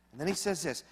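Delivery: ordinary talk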